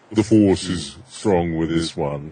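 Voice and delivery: deep, slow processed villain; Darth Vader. Shown as a demonstration. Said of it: "in order to do Darth Vader".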